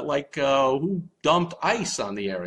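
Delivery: ordinary talk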